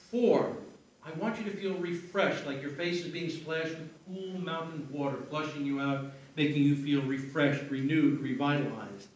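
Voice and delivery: soft, measured hypnotist voice with long pauses; hypnotic count-out. Real, not acted. Hypnotist counts participants out of trance.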